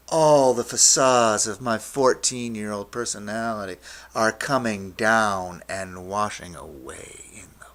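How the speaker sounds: loud surge ebbing away; grand swelling narration. A narrator's reading. Storyteller at the trip's peak, loud surge fading. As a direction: start big and let it wash out. Flood of the trip peaks.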